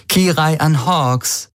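high, drawn-out playful call; slow, lilting mock summons; amused. A narrator's reading. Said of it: "sagte sie erheitert".

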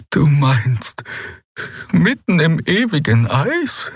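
slow, high, halting disbelief; dismayed question. Acted. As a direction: draw it out high, dreading the cold. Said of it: "Ein Frösteln ließ Xeas Körper erbeben".

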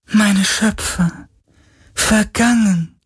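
high, slow, mournful spirit wail; lamenting spirit. A narrator's reading. Sea spirit mourning its dead creators, high and slow. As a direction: grieve for your lost makers, drawn out. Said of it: "klagte der Geist".

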